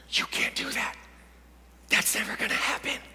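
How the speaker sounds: hushed, whispery naysayer; soft whispered warning; inner voice of doubt. Acted. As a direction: whisper discouragement into their head. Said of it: "A voice that says".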